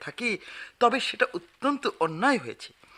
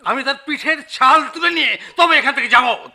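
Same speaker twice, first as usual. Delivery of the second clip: louder, higher furious vow; angry threat; 7 semitones higher, 7 dB louder than usual. Acted. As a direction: threaten him hotly. Manager threatens the poet. (রাগে হুমকি)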